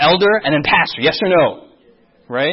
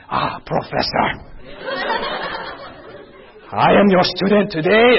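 awed student, breathy then declaiming; mock automatic respect; 2 semitones higher than usual. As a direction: greet the professor with exaggerated reverence. Acting out automatic respect for professor.

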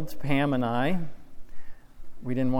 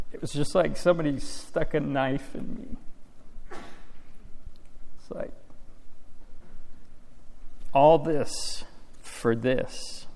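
crying. Real, not acted. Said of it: "Sorry, I'm crying".

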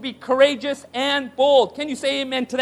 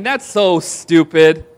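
low, slow and dismissive; scoffing thought; 8 semitones lower, 5 dB louder, much slower than usual. Retold or imitated. Listener scoffing at David's speech, slow dismissive mutter. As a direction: scoff at the idea under your breath. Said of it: "you're probably thinking".

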